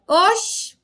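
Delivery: a quick surprised Bahian "oxe". Shown as a demonstration. Teacher voicing a startled Bahian, mock Bahian exclamation. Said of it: "Na Bahia".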